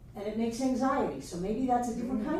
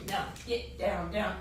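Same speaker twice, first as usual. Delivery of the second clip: lower, softer, firm command; stern ego voice; 5 semitones lower, 4 dB quieter than usual. Acted. Teacher voicing the ego silencing the inner self, low and stern. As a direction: push the little voice back down.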